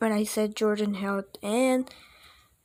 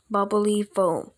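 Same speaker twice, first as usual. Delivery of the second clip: plain reading voice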